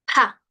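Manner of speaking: Pa with a burst of air; aspirated P. Shown as a demonstration. Teacher models aspiration, Pa with a puff of air. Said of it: "con aire".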